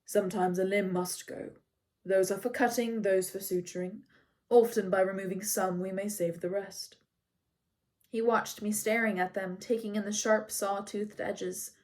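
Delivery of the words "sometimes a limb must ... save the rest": even, factual centaur voice; lightly voiced calm centaur; neutral and calm. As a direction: state it plainly and calmly. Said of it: "His voice was neutral and calm, factual".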